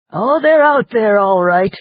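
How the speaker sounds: high, loud, boisterous little man; hearty loud reply. Acted. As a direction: bark it out cheerfully. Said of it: "Pegleg replied".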